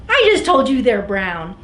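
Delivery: usual speaking voice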